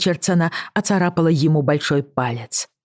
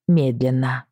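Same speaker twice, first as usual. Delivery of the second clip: plain reading voice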